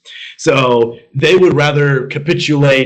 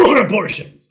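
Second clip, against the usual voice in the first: high animal call; animal impressions; 8 semitones higher than usual. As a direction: call it out like a beast. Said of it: "you know what sound that is".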